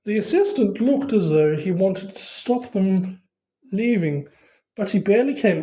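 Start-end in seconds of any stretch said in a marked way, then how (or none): none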